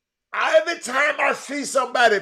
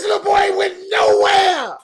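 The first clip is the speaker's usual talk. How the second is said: anguished shout; 12 semitones higher, 9 dB louder than usual. Real, not acted. Exasperated about the boy.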